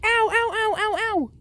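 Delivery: high, rapid run of ows; mock pained yelps. Deku flicked on the head, high mock yelping.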